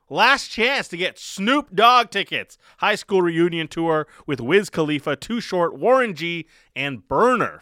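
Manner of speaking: ordinary talk, nothing marked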